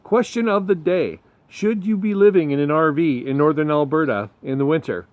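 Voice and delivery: punchy show-intro announcer read; loud, bright hook for the show opener. Acted. Show intro over music.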